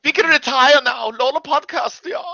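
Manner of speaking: high-pitched mock German; squeaky hammed-up German; terrible German accent. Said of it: "That's a terrible German accent".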